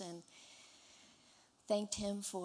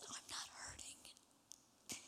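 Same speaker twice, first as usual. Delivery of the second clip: slow, breathless whisper of a dying man; faint whisper; 11 dB quieter, much slower than usual. Retold or imitated. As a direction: barely breathe the words out. Dying father's weak reply retold.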